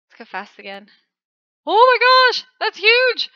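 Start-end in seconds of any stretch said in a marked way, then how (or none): 1.6-3.3 high, loud amazed cry; excited shout. Real, not acted. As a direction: shout in astonishment.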